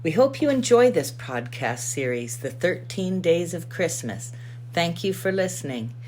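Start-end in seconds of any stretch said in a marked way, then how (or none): none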